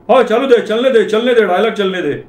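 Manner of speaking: put-on high-pitched, egging-on elderly voice; old Parsi officer. Parsi visitor urging the husband to continue, high old-man voice. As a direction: urge them on as an amused old Parsi. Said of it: "ढोलकवाला बोलला".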